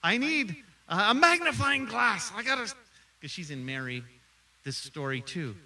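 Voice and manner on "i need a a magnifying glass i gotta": eager Sunday school teacher. Retold or imitated. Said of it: "She's like".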